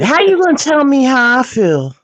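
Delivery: high-pitched indignant protest; indignant comeback. Real, not acted. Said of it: "'Cause she said".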